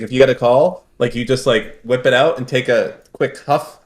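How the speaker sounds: normal talking voice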